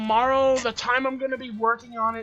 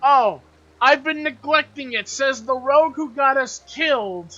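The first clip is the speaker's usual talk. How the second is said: loud, higher-pitched indignant comeback; indignant retort; 5 semitones higher, 8 dB louder than usual. Real, not acted. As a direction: throw the blame back, loudly. Mock quarrel over game deaths.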